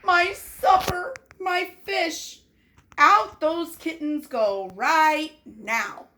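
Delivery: dad upset over his supper, angry; upset father. Acted. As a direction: fume about the lost fish. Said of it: "said Mister Dooley".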